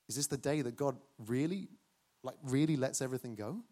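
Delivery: ordinary talk, nothing marked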